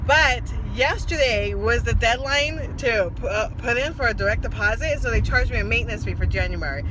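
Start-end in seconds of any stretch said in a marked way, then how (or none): none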